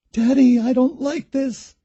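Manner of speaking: put-on high, small frightened girl voice; frightened daughter; scared little girl. A narrator's reading. Scared girl in dark hallway.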